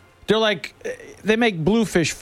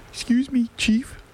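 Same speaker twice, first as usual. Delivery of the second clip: put-on higher-pitched little kid voice; lightly voiced kid; 6 semitones higher than usual. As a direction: lightly mimic a child piping up to an adult. Imitates neighbor kid in anecdote.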